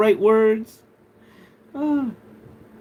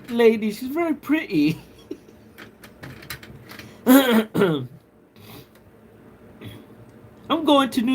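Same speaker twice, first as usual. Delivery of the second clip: a put-on posh British accent, pitched up; prim British voice, higher than own; mock posh British; 7 semitones higher than usual. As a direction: ham up a posh English lady. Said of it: "Am I doing it right?".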